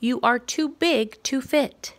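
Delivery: playful pretend yell at the moose; mock shout. Reader voicing the child telling the moose off, mock shout. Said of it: "Would you shout".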